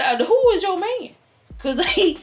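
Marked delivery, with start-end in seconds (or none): 0.0-1.1 high, puzzled who is your man; mock surprise. Grandma feigning innocence to the jealous woman, high and puzzled. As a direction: play dumb, sky-high and innocent. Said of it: "And I said".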